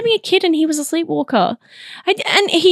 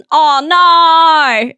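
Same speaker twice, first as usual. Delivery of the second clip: long, louder Australian "oh no"; Australian accent; 6 dB louder than usual. Shown as a demonstration. Checking if listeners hear it, exaggerated Australian accent. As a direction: belt out a broad Australian accent. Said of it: "Are you hearing that as well?".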